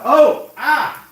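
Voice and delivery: sharp, high, loud yelps of pain; cry of pain. Real, not acted. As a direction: yelp at the sudden sting. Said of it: "my left eye is burning".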